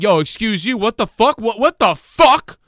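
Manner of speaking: hammed-up indignant yelling; mock confrontation. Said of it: "and be like".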